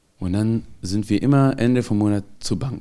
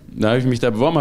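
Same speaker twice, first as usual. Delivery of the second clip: ordinary talk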